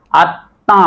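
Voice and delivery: আত্মা said at-tã, m silent; nasalised ending. Shown as a demonstration. Teacher demonstrates the rule, nasalised. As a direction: double t, then a nasal long vowel in আত্মা. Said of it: "চন্দ্রমেন্দ্র হবে".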